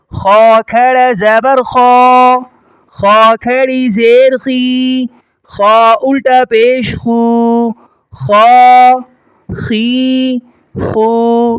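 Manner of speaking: slow drill, "خا" thick like "kho", held level; heavy kha. Shown as a demonstration. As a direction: thicken "خا" and stretch "خی، خو" evenly. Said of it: "موٹا کریں گے". ("خا" موٹا کر کے، کھینچ کر)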